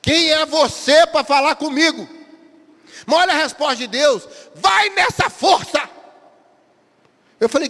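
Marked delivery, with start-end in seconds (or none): 4.5-5.9 booming divine command, high and loud; a slow, high, shouted word from God. Acted. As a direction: proclaim it like God sending him out. God answers Gideon.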